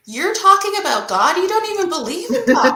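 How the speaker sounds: high, loud disbelieving challenge; incredulous retort. Retold or imitated. Teasing her friend about suddenly mentioning God, high and loud in disbelief. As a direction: throw the friend's own doubt back at her, amazed.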